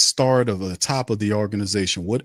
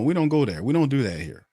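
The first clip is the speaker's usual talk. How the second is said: ordinary talk, nothing marked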